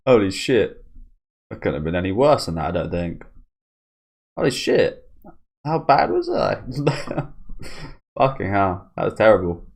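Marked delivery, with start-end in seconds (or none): none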